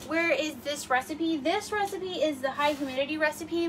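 normal talking voice

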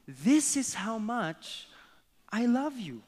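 high, slow, drawn-out little rabbit; small rabbit voice stretching out the words. Acted. Little rabbit showing love with small arms, childlike and high. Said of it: "with his small rabbit arm".

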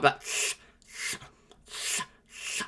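"s" as a weak, slushy unvoiced hiss after tongue surgery; sustained "s" hissed, lisping after tongue surgery. Shown as a demonstration. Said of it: "the S sound".

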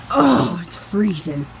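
ordinary talk

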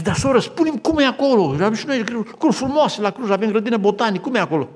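high, loud, pleading question; an eager nurse pressing an old sister for answers; eager plea. Retold or imitated. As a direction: beg her to tell you, voice lifted high. Ana eagerly asking about heaven.

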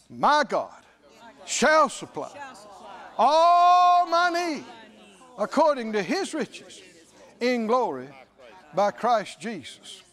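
loud scripture proclamation, swelling on key words; bold declaration. Shown as a demonstration. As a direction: declare it with drawn-out, ringing emphasis. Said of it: "You should say".

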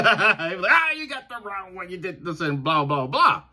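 high, laughing mock nagging; mock complaining fans. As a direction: imitate fans tearing into you, half laughing. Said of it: "some fans that are just tearing me to pieces".

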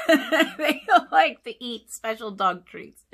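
laughing through the words, slow and loud. Real, not acted. Narrator laughs telling kids about her dogs' treats. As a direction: chuckle while telling it.

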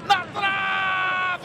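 excited shout. Real, not acted. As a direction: shout it hit the post. Near miss shout. (grito de bola na trave)

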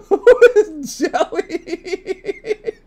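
high squealing laugh through the words; real high-pitched laughing fit; helpless laughter. Real, not acted. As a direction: crack up mid-question, last word dissolving into giggles. Cracking up at a name.

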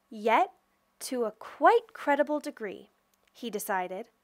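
usual speaking voice

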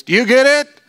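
high, loud, pushy; hammed-up teacher pressing the point; high, loud, slow at the end; comic loud Jesus. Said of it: "I can hear Jesus look at the disciples and go".

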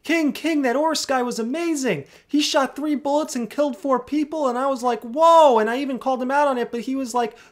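high, loud and breathless with excitement; excited. Real, not acted. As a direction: gush it out like big news. Excited report to the boss.

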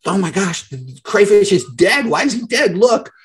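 high, alarmed mock panic; imitating panicked fiance. Fiance finding the crayfish seemingly dead, high mock panic. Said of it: "she's freaking out".

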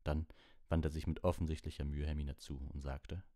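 plain reading voice

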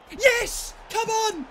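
high, excited shout; triumphant cheer. Real, not acted. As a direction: cheer them on at a high pitch. Celebrating in the shootout.

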